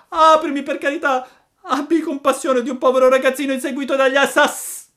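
high, loud frantic begging; desperate pleading cry. A narrator's reading. Hunted puppet begs for pity, frantic shout. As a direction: beg at full voice until cut off mid-word. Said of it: "gridava Pinocchio".

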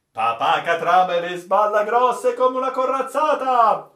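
high, loud mocking shout of the crew. The crew loudly jeers at the old sailor's tall tale. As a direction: jeer it out loudly. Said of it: "gridarono tutti".

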